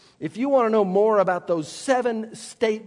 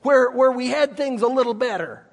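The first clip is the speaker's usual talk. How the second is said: higher, quicker whiny grumble; grumbling complaint; 6 semitones higher, 3 dB louder, faster than usual. Retold or imitated. Preacher voicing Israelites complaining in wilderness, whiny grumble. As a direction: whine like a fed-up wanderer. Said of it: "people that grumbled and said".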